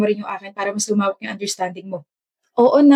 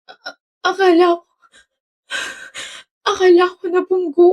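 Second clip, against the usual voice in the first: higher, teary, stammering voice; girl in tears after thinking he was hit, crying; 7 semitones higher than usual. Acted. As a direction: choke the words out through tears. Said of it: "Bakit ka umiiyak?".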